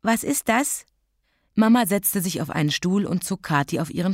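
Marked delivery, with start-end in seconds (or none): 0.0-0.9 put-on small, high child's question; tearful curiosity. A narrator's reading. Sniffling child asking Mama what that means, timidly curious. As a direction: ask timidly, pitched up. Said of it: "Kathi schniefte".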